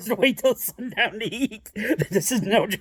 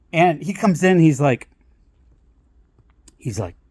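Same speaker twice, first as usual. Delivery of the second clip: normal talking voice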